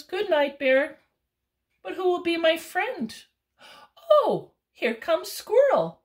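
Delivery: high, loud raccoon; a lonely raccoon brightening at squirrel. Acted. Raccoon wondering who his friend will be, high.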